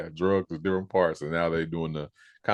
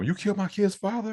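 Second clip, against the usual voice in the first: mock shocked; 13 semitones higher than usual. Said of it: "Tariq gonna be just like".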